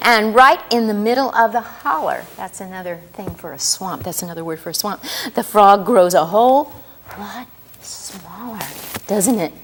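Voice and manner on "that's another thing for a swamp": ordinary delivery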